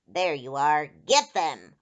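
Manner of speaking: loud call, starting low; menacing cry. A narrator's reading. Rider above spots children, loud menacing command. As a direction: shout an attack order. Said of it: "a voice cried out from above".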